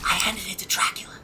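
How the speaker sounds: hushed, fast whisper. Acted. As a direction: whisper it like a secret. Sneaky aside to the game master.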